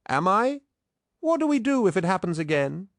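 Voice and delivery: very high-pitched, anxious questioning; agitated challenge. A narrator's reading. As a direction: throw the question back, voice way up. Loken challenges his comrades, agitated.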